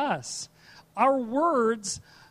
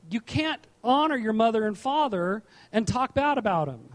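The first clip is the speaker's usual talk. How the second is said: usual speaking voice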